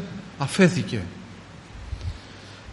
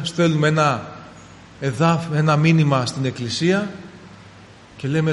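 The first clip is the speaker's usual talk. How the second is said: ordinary talk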